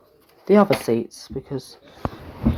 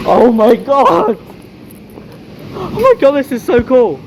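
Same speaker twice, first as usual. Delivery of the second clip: loud, high thrilled squealing; excited squeal; 6 semitones higher, 7 dB louder than usual. Real, not acted. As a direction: shriek with pure delight. Thrilled at seeing the train.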